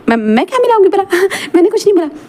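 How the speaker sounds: high, quick, giggling through a guilty denial; nervous laughing denial. Acted. Mam nervously laughs off Wing's question about the drink.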